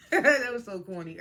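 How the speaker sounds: real laugh running into the words; amused at own corniness, laughing. Real, not acted. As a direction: crack up at yourself while talking. Laughs at own silly moment.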